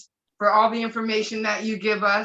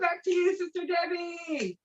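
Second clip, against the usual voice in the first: excited handoff; 7 semitones higher, 7 dB quieter than usual. Real, not acted. Handing back, still excited.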